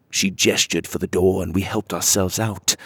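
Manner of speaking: plain reading voice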